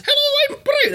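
very high squeaky little puppet greeting. Retold or imitated. The little Scottish puppet introducing himself in a high squeak. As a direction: pop up like a tiny hand puppet. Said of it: "it was a little Scottish puppet".

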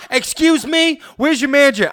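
high, loud entitled customer voice; demanding customer. Acted. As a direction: demand the manager haughtily. Acted customer demanding manager.

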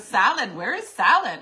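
hammed-up repeating of Salad; mock insistent Jurgen. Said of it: "He goes".

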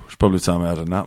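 dull, sleepy panda mumble; bored pandas. Acted. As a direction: trail along with nothing to say. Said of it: "that's what a conversation with a panda is".

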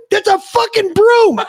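high, slow exasperated yell; incredulous sergeant. Retold or imitated. Drill sergeant pointing out the broom. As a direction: spell out the obvious in disbelief. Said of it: "he goes".

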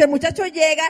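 ordinary talk

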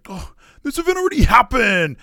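mocking quote. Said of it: "podcasters that are always like".

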